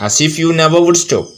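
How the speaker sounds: slow, measured verse reading; poem recitation. A narrator's reading. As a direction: recite the verse slowly for pupils.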